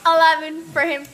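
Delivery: loud. Real, not acted. Teasing, louder rating.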